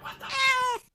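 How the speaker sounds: hushed whispered protest; very soft, acted-out bewilderment; mock alarmed whisper. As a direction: whisper it as if frozen in bed. Lying in bed, cat staring.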